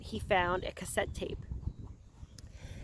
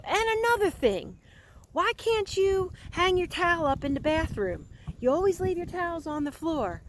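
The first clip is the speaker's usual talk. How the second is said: high, pushed-up ranting complaint; ranting roommate; 9 semitones higher than usual. Retold or imitated. Retelling a taped rant about a roommate, mock indignant. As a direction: mimic a fed-up guy nagging his roommate. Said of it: "so I sound like that guy right now".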